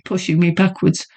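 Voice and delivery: ordinary delivery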